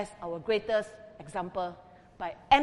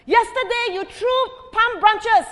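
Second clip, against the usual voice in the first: high, slow, loud desperate cry; shouting; 11 semitones higher, 9 dB louder than usual. A narrator's reading. Donkey shouting for palm branches in the street, high and loud. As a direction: wail it out, demanding what's missing. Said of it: "he shouted".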